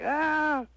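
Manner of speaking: a high, drawn-out groan of frustration; exasperated groan. Retold or imitated. Caller fed up with telemarketers, exasperated high groan. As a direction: let out a fed-up "ah". Fed up with Chinese sales calls.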